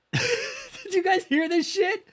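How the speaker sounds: slow, very high laughing squeal; laughing disbelief. Real, not acted. Asking chat if they heard it, laughing. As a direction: laugh through the words in disbelief.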